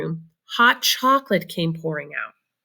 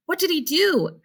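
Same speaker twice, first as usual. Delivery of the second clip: put-on much higher, eager child's question; eager little boy; 11 semitones higher than usual. A narrator's reading. Charlie begs Grandpa for the story, eagerly high. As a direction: ask it like an excited small boy. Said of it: "asked Charlie eagerly".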